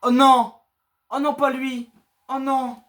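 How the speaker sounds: high, loud dismayed cry; panicking gamer. Real, not acted. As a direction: groan in horror at the monster. Monster appears, gamer dismayed.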